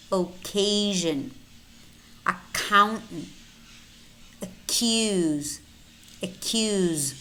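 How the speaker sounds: occasion, accountant, accuse with one K for the double C; no S after the K in accuse; single K sound. Shown as a demonstration. Teacher models double-C words, single K as in accuse. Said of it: "it's only one K".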